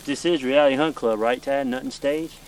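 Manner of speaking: ordinary delivery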